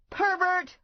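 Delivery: high loud indignant yell. Acted. Stephanie yelling at Big Dog, high indignant yell. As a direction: fling the insult up high and loud. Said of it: "I yelled".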